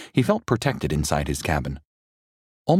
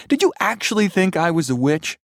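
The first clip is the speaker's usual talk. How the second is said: high, loud mocking voice; mocking tease; 12 semitones higher, 3 dB louder than usual. A narrator's reading. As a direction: rub it in with glee. Mary teasing.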